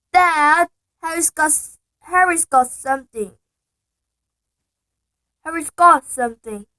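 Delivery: high, loud whiny tattle; Dudley tattling. Acted. Spoiled son loudly telling on his cousin. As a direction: tell on Harry at the top of your voice.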